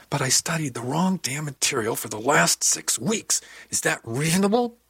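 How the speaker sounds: high-pitched frustrated protest, climbing; upset outburst. A narrator's reading. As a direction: protest in frustration, voice rising to the question. Upset outburst over wasted study.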